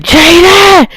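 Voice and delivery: name called out very high and drawn out; retold yelling. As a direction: imitate an angry parent yelling for a child. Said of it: "yelling at her".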